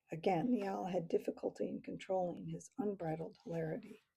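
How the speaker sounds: plain reading voice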